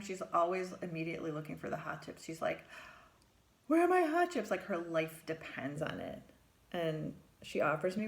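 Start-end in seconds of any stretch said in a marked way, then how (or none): 3.7-4.5 put-on high, loud child's whine; demanding kid. Girl bursting in asking the therapist for her hot chips, high and loud. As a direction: demand the chips like your life depends on it.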